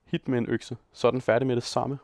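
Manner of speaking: ordinary delivery, nothing marked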